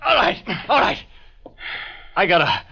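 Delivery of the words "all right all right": high, frightened yelps of pain; panicked pleading. Acted. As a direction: beg him to let go, voice cracking. Witness being roughed up, pleading.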